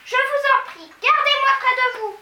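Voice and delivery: high, loud pleading cry; a girl begging her teacher. A narrator's reading. Mathilda begging.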